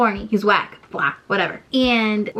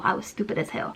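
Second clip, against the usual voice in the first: whispered, lower and much softer; whispered aside; 5 semitones lower, 10 dB quieter than usual. Shown as a demonstration. Whispering a self-scolding aside to the viewers. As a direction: whisper it to yourself like a confession. Repeats line, second whispered.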